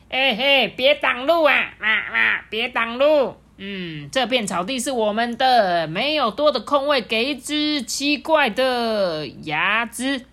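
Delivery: loud, high scolding goose voice; bossy geese. Acted. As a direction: honk it out to chase off an intruder. Geese drive the cat away.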